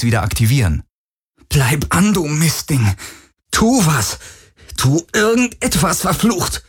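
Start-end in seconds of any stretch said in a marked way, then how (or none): 1.5-6.6 frantic cursing. A narrator's reading. Cursing the dying Helix.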